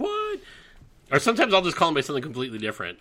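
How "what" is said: very high squeaked "What?"; mock confused character. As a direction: squeak it like a startled chipmunk. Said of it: "they get all like".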